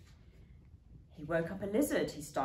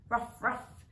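a light unvoiced ruff; breathy dog ruff; 5 semitones higher than usual. A narrator's reading. Muffin Maclay huffs barks up the tree. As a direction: huff out two barks. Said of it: "said Muffin Maclay".

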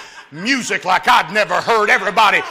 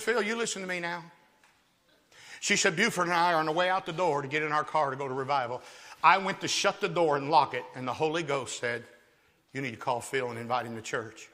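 low, soft, earnest aunt on the phone; solemn aunt; 7 semitones lower, 11 dB quieter than usual. Retold or imitated. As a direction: hush it down like a solemn warning.

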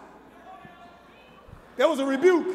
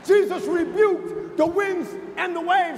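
slow fiery preaching; 6 semitones higher, 5 dB louder, slower than usual. Acted. Preaching at full force.

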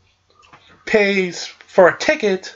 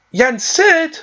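much higher startled question; surprised question; 9 semitones higher than usual. Retold or imitated. Donald and Goofy realize it's their master, surprised high question. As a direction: blurt it out in shock.

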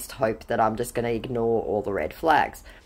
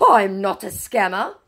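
protesting scammer; 5 semitones higher, 4 dB louder than usual. Retold or imitated. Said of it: "Because you're a fake and a scammer".

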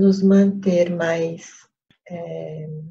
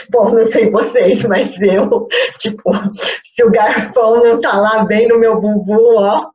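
louder, higher, giggly confession; playful and loud; 4 semitones higher, 9 dB louder than usual. Real, not acted. Meditation teacher jokingly confessing to her listeners, loud and amused. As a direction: own up to it with amused gusto.

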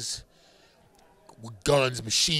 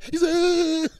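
laughing; 6 dB louder than usual. Retold or imitated. Mocking David's frightened reaction.

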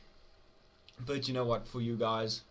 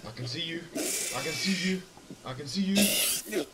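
creepy singsong taunt; 7 semitones higher, 6 dB louder than usual. Acted. Player voicing the creepy ghost hunting him, a high eerie taunt.

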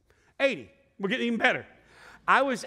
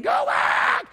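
high unvoiced yell, louder; screamed; 5 dB louder than usual. Fan in the stands screaming at the marching band, high yell.

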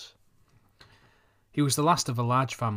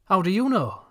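sharp, louder and higher, annoyed; rather angry; 8 semitones higher, 6 dB louder than usual. A narrator's reading. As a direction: snap it back at him, stung. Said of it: "I said rather angrily".

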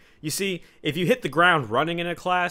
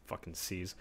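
muttered curse; 7 semitones lower, 18 dB quieter than usual. Real, not acted. Muttered aside after sigh.

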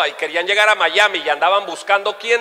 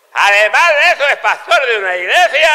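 loud, raised announcer impression; Don Francisco; 5 semitones higher, 6 dB louder than usual. TV host introducing the comedian, loud impression. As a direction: belt it out like a TV host presenting. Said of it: "lo que dijo don, don, don Francisco".

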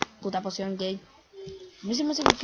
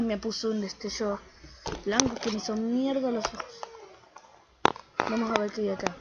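ordinary talk, nothing marked

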